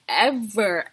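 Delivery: ordinary talk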